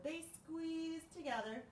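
ordinary delivery, nothing marked

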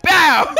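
loud, high gunshot imitation; gunshot sound. Real, not acted. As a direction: blurt a gunshot noise. Imitating gunshot while laughing.

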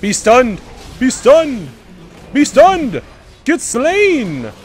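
loud, high frantic commands; battle shouting. Real, not acted. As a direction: bark it mid-fight. Frantic team fight.

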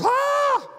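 sudden high shriek of shock; retold scream. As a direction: let out a sharp startled shriek. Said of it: "she literally screamed".

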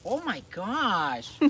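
loud, slow, delighted praise; excited praise. Real, not acted. Praising dog loudly.

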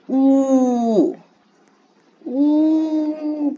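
loud impressed ooh. Real, not acted. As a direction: belt out an ooh.

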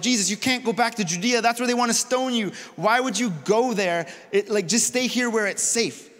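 high-pitched, urgent objection; incredulous, worried pleading; alarmed protest. Acted. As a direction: talk him out of a dangerous trip. Disciples object to Jesus' plan.

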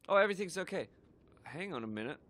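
ordinary talk

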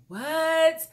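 high, loud shocked what; telling the viewers how she reacted at the store, a shocked high yelp. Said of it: "So I was like".

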